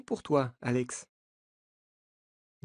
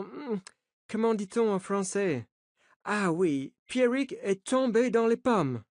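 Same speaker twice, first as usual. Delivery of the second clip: put-on the coach, higher, with an American accent; 8 semitones higher than usual. A narrator's reading. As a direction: reassure the journalists in an American accent. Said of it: "avec un accent américain". (accent américain, rassurant)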